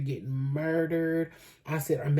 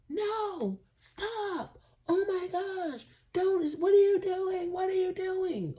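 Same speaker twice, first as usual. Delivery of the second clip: mock shriek, much higher; imitating a screaming neighbor; slower than usual. Retelling the neighbor's scream, mock high-pitched shriek. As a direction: squeal it like the panicked woman upstairs. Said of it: "Like, loud, top of the lungs".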